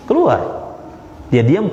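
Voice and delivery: ordinary talk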